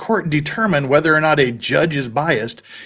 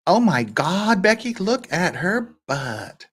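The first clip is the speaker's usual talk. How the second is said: slow, drawn-out mock valley girl; lightly put-on valley girl gasp; quoting the song; 5 semitones higher, slower than usual. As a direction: drawl it out like the famous song intro. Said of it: "as the statement goes".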